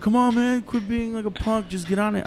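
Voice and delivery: high, pushy taunting voice; mocking cousins. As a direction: egg him on like teasing cousins. Cousins taunting him on dirt bikes.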